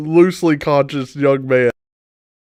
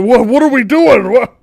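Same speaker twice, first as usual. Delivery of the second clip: louder, higher confused "what are we doing"; startled question; 6 semitones higher, 6 dB louder than usual. Acted. Confused startled shout. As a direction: Harvick baffled by the sudden fight. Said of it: "he's like".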